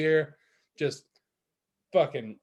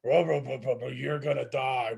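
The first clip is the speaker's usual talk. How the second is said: very slow, eerie drawn-out warning; hallucinated heater threatening a tripping teen, eerie; creepy heater voice; 3 semitones lower, much slower than usual. Retold or imitated. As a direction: intone it slowly like a haunted machine.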